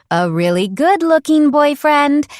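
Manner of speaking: high, loud giddy boast; gushing. Acted. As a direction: squeal it, thrilled. Diary gushing.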